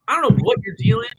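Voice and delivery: high, loud, quick mock outburst; retold angry retort. Customer snapping at lost delivery driver, exasperated. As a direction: fire back fast, acting fed up. Acted quarrel with yelling driver.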